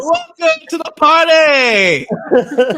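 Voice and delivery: high, loud, drawn-out party holler; shouted welcome. Real, not acted. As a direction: holler it slowly with a laugh. Rowdy greeting to newly spotted wife.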